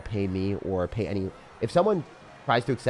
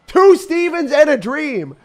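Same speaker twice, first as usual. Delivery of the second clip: much higher, louder and slower announcer shout; hype shout; 13 dB louder, slower than usual. Pretend. Streamer hyping his meme deck to chat with a big shout. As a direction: bellow it like a ring announcer.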